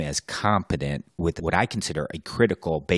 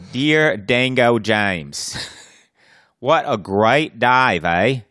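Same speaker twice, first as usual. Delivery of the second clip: slow, louder hammed-up Aussie voice; mock Australian accent; 4 semitones higher, 4 dB louder, much slower than usual. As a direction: draw it out like a jokey Australian. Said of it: "it's Australian for diving".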